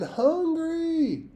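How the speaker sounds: shrill, very high loud wail. A narrator's reading. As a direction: let the word rise into a ghostly howl. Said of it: "you wailed again".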